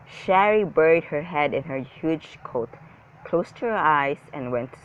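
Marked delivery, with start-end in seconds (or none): none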